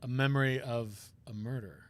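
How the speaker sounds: low, soft, hesitant whispering voice; a pained man confessing quietly; troubled prosecutor. Acted.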